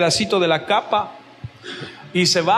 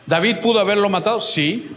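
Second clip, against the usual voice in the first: ordinary delivery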